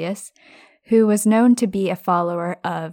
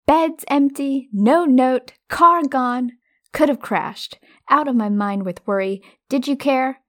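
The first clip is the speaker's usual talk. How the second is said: raised, high-pitched mock scolding; scolding mother; 6 semitones higher, 5 dB louder than usual. Mother scolding her sons after the missing car, acted indignant scolding. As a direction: rattle off the worries like an upset mum.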